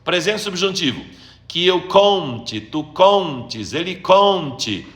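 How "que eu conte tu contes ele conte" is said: slow, held CON in "conte", "contes", "conte"; stress on CON. Shown as a demonstration. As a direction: stretch the first syllable of "conte" and "contes". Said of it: "Os três com". (tônica marcada no CON de "conte", "contes")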